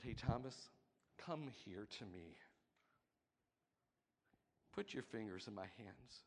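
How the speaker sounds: soft, gentle call to come close; tender invitation. Retold or imitated. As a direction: coax him in softly.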